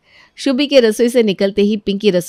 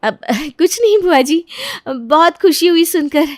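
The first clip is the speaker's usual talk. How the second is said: forced nervous laugh; 8 semitones higher than usual. Acted.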